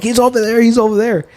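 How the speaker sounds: high, insistent pointing out; excited retold call to the officer; urgent call. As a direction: point the cop toward him, urgently. Pointing the cop to suspect.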